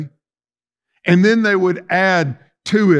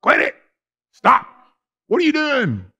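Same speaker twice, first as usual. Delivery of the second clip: sharp, high, mock-angry outburst; homeowner having a fit; 9 semitones higher than usual. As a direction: play the flustered homeowner yelling at the intruders. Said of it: "he's probably having a fit".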